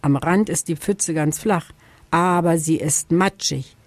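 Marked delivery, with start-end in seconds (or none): none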